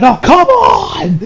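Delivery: high, drawn-out mock wail; exasperated cry. Hidden friends seeing the maid leave, exasperated high cry. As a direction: wail it long in imitated disappointment.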